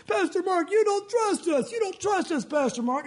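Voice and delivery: high, pleading congregant voice; whiny complaint. Retold or imitated. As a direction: mimic a hurt church member protesting.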